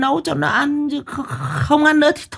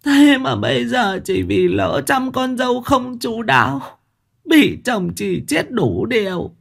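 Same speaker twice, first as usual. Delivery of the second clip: wailing lament; 13 semitones higher than usual. A narrator's reading. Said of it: "khóc bù lu bù loa".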